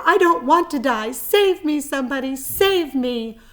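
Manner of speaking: high, loud pleading cries; terrified pig screaming for help; panicked screaming. A narrator's reading. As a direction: beg for rescue in panic. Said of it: "screamed Wilbur".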